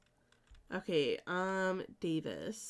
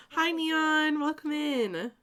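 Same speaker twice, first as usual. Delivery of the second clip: usual speaking voice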